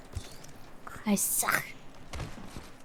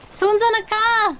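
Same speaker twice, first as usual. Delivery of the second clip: loud, higher alarmed callout; excited callout; 8 semitones higher, 5 dB louder than usual. Real, not acted. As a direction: yell out the spotted enemy. Spotting enemy in game.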